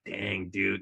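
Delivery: low quiet sigh of dismay; quiet dismay watching the deer die; soft dismay. Retold or imitated. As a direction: breathe it out, sadly. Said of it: "And it's like".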